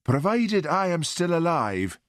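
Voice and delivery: reply a little stiffly. A narrator's reading. Sick son answering mother, stiff. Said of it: "said Hamish stiffly".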